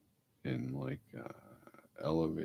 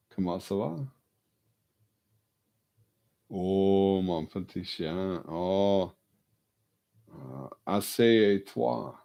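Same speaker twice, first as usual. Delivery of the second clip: French to dog; 3 semitones higher, 5 dB louder than usual. Shown as a demonstration. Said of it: "I'll switch to French".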